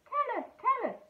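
high, eager pleading; begging fish. Acted. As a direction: beg like excited little fish. Fish begging Barry to tell.

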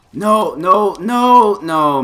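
loud, high desperate cry of no; frustrated shout. Real, not acted. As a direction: yell it as the fight slips away. Losing a close game fight.

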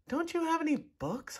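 put-on small boy. A narrator's reading. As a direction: ask like a sleepy little boy. Small boy asks the pirate.

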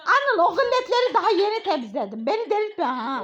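loud exasperated scold; angry scolding. Real, not acted. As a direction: warn him sharply, loud. Mother scolding son.

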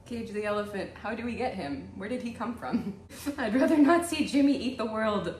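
ordinary talk

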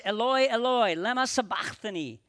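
a loud anguished cry, strongest on Eloi. Acted. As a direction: call it out loud in anguish, easing on the second half. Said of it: "cried out in a loud voice".

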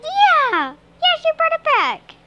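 high, loud coaxing call to the cat; sing-song call to bring the cat over; squeaky "come on" to a pet. Real, not acted. Calling and praising cat.

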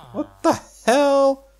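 squeaky high what the hell; high surprised. Real, not acted. Finding the house locked, squeaky high disbelief.

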